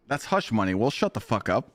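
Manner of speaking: ordinary talk, nothing marked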